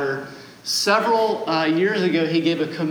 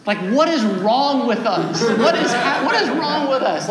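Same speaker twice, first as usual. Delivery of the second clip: laughing exasperation; 7 semitones higher, 4 dB louder than usual. Real, not acted.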